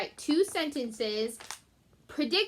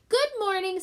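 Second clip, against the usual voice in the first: Stuart's voice; 9 semitones higher, 4 dB louder than usual. Acted. Stuart greets cat, high voice.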